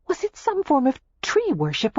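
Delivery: ordinary delivery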